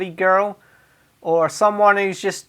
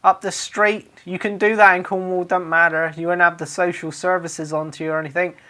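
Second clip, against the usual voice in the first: ordinary talk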